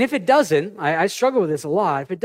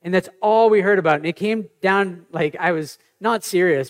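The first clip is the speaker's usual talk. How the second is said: normal talking voice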